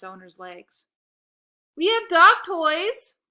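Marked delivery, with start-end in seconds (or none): 1.8-3.2 loud, much higher slow shout; host shouting over the dogs, loud. Acted. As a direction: call out to distract the dogs. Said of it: "I shouted".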